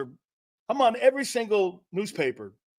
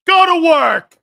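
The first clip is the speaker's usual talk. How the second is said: loud shout; 11 semitones higher, 14 dB louder, slower than usual. Real, not acted. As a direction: bellow it out as a rallying cry. Pep shout to his team.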